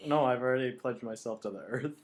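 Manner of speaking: usual speaking voice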